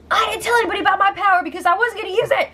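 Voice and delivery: hammed-up high fast yell through laughter; mock scream laughing. Bailey shrieking at Tyler, imitated high and laughing. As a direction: imitate her screaming while cracking up. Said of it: "She's screaming so hard".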